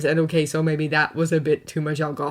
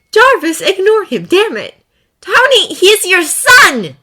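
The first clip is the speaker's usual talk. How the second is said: much higher, much louder yelling; furious shout; 14 semitones higher, 11 dB louder, slower than usual. Acted. Potts shouting at Tony and Jarvis, furious yell. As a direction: shout him down.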